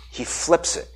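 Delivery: hushed aside to the congregation; whispered reveal. Real, not acted. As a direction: whisper it like a secret. Revealing key point.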